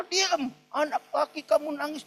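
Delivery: high-pitched stern order; acted scolding father. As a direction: silence a crying son sharply. Acts harsh father silencing the child.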